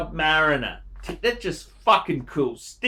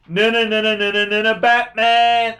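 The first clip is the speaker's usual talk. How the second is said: mock protest; 3 semitones higher, 5 dB louder than usual. Mock protest about the Batman.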